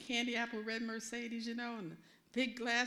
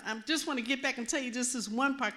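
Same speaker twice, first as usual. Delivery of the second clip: ordinary talk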